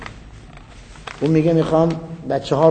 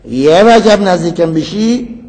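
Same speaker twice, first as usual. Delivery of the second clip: یه وجب نزدیکم بشی said louder, slow, with a rise on وجب; loud slow threat; 4 semitones higher, 8 dB louder, slower than usual. Shown as a demonstration. Preacher repeating the warrior's threat to Ali, louder and slower. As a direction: lean into the threat, slowly.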